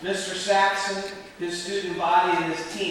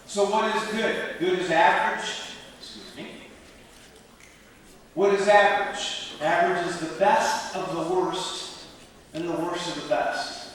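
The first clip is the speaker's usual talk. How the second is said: ordinary delivery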